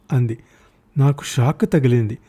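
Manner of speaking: ordinary delivery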